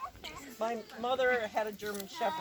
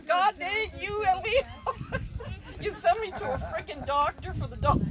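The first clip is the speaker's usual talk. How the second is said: laughing mock scolding; 7 semitones higher than usual. Mother scolding, teller laughing.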